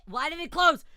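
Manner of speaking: startled, loud protest; shocked shout. Real, not acted. Game suddenly closed on streamer.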